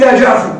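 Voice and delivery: يا جعفر said as جعفُ, ending in u; with damma. Shown as a demonstration. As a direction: end يا جعفر on a clear u sound. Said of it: "بالضم".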